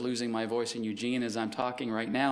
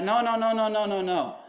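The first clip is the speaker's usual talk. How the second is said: higher, rapid no-no-no, half laughing at him; exasperated correction; 6 semitones higher than usual. Retold or imitated. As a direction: wave off the wrong answer with a string of quick nos. Correcting the driver's wrong answer.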